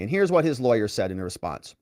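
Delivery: normal talking voice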